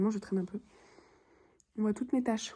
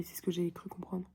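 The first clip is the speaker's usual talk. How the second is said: whispering; 2 semitones lower, 7 dB quieter than usual. Real, not acted. Whispered aside.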